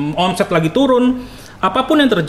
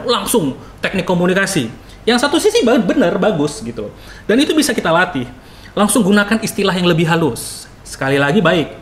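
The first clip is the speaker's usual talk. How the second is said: ordinary talk, nothing marked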